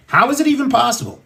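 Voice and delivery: rising exasperated repeat of the question; exasperated disbelief. Real, not acted. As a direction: fire the question back up in outrage. Repeats the question, then escalates.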